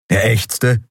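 ordinary delivery

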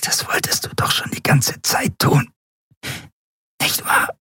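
voiceless, strangled whisper; faint, goading whisper; barely intelligible. A narrator's reading. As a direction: whisper it with the last of your breath. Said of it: "Endriels Worte waren kaum zu verstehen".

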